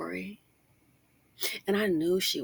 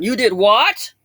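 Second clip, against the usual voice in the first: much louder mock husband shouting; husband's outburst; 4 semitones higher, 11 dB louder, slower than usual. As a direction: blurt it like a shocked husband. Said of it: "he'll be going".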